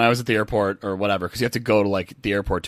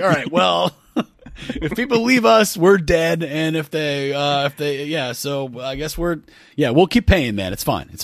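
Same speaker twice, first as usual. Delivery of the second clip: imitating Clear executives; 5 semitones higher than usual. Clear executive panicking about losing members. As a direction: play a nervous company boss, then deflate. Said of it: "It's like".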